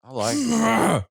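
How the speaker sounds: garbled stream audio; corrupted audio. Real, not acted. Audio glitch mid-stream.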